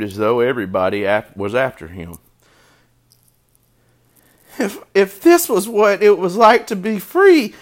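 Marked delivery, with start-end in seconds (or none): 4.6-7.5 high, loud little-pig voice; fretful piglet, pitched way up; distressed young pig. A narrator's reading. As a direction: voice a cornered piglet, high and upset. Frightened cornered pig thinking, acted.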